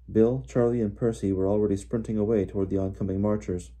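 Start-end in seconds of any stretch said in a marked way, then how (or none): none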